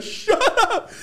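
very high, loud squeal of amazement; disbelieving shriek. Retold or imitated. Kid watching uncle get in a car in game, disbelieving shriek.

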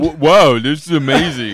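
hammed-up radio host wow; mock shock-jock voice, bursting in loud; exaggerated radio personality caricature; Howard Stern. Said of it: "Howard Stern".

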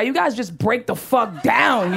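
usual speaking voice

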